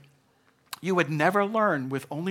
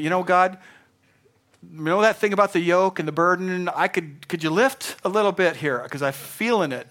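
higher, louder, half-joking whine for relief; whining to God; 5 semitones higher, 6 dB louder than usual. Retold or imitated. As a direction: beg God to lighten the load, mock-complaining. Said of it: "I was kinda whining to God".

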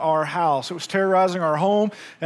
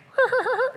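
mock cricket laugh. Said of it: "like it was laughing at me".

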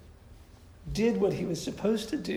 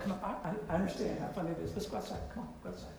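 gentle coaxing; 9 dB quieter, much faster than usual. Retold or imitated. Jesus gently ushers mourners out.

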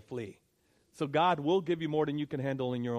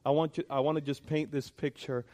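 normal talking voice

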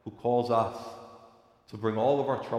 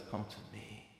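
much softer, hushed invitation; loving call; 2 semitones lower, 16 dB quieter, slower than usual. Acted. God lovingly calling his people, soft and tender. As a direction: speak it gently, like a tender invitation. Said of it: "he lovingly cries out to you".

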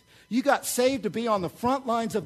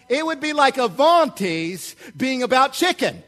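louder, higher preaching at full force; rally the church with energy; fired-up preaching; 5 semitones higher, 5 dB louder than usual. Acted. Pastor preaching to congregation.

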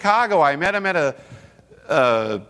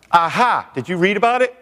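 higher, triumphant gotcha; retold gloating; 5 semitones higher than usual. Atheist caller asking about the pastor scandal, mock triumph. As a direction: crow it as an imitated caller.

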